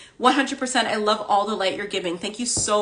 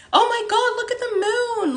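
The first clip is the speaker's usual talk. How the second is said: much higher, excited squeal of wonder; amazed exclamation; 3 dB louder than usual. Retold or imitated. Crossing the street, she sees the full moon and squeals in wonder. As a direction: gasp it out at the sight of the moon. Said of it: "I literally said it out loud, just like that".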